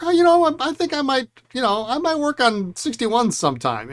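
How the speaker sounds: mock-innocent high voice; breezy casual self-quote. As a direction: toss it off like it's no big deal. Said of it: "and I was like".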